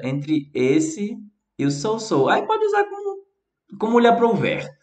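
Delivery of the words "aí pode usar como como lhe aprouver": playful squeak. Acted. Playful aside.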